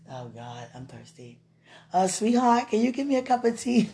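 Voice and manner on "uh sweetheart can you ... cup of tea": playfully voiced sweet request, pitched up; trying out the husband line; pretend husband. To wife, mock tender husband ask. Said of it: "I can't wait to say stuff like that".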